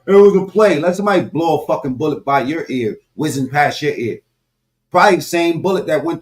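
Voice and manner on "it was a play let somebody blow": mock high voice, stress on was; mocking quote. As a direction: sneer the quote back at them. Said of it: "they still talking".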